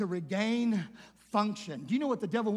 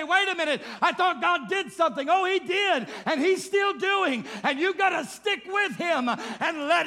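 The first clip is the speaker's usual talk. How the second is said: louder preaching, voice raised; full-force preaching; 6 semitones higher, 7 dB louder than usual. Acted. Preacher building a healing testimony for the church, full-force preaching. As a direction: preach it out loud to the congregation.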